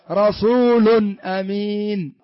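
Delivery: very slow "رسول أمين", tanween sounded as a clear n; إظهار التنوين. Shown as a demonstration. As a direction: draw out "رسول", land its final n, then "أمين". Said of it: "ظهرت ولا ما ظهرت؟". (نطق "رسول أمين" ببطء مع إظهار نون التنوين)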